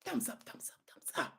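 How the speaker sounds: very faint, repeated whisper; whispered aside. Real, not acted. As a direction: whisper it quickly under the talk. Aside to viewers.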